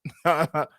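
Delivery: short chuckle at someone's excuse; amused laugh. Real, not acted. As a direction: let out a brief, amused laugh. Scoffs at the changing excuse.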